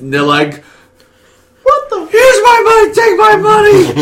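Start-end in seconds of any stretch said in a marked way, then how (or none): 1.6-4.0 very high, loud comic frenzy; frantic mock shout. Fans throw money at a dream double bill, mock frantic shout. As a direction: screech it like an overeager buyer, hammed up. Acting out eager ticket buyers.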